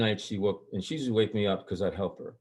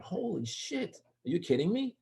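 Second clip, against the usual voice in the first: stunned, very high-pitched exclamation, softer; retold shock; 10 semitones higher, 4 dB quieter than usual. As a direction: re-enact the disbelief, pitched way up. Acts out shock at son's answer.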